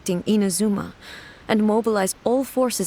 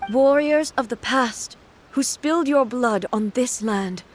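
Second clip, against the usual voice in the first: solemn address; 3 semitones higher than usual. Acted. Inazuma's god vowing to the fallen warriors, solemn and measured. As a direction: speak over the battlefield as a god honoring the dead.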